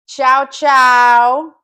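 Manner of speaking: loud sing-song goodbye; playful shout. Real, not acted. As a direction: call out ciao ciao brightly.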